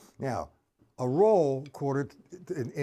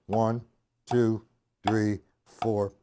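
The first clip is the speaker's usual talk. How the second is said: nice and slow count; 2 semitones lower, much slower than usual. Shown as a demonstration. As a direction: count it slowly and stress five. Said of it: "Nice and slow".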